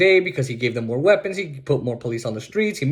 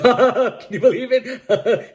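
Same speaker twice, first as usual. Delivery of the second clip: slow laughing question, pitched up; 7 semitones higher, much slower than usual. Real, not acted. Vlogger laughing at the attack on Biden's son. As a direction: laugh through the words in disbelief.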